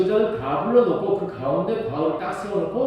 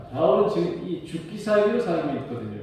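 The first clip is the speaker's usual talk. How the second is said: usual speaking voice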